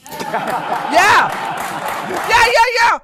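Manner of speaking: loud, high laughing cheer; excited shout. Real, not acted. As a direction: whoop in agreement. Cheering agreement.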